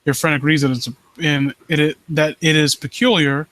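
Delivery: low, hushed creepy-story reading; story narration. Acted. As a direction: draw the listener into the dread. Reads creepy story aloud.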